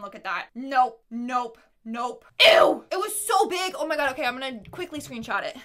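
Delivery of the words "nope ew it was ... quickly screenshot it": ordinary delivery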